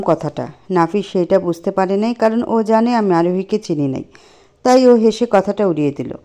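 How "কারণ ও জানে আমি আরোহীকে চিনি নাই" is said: plain reading voice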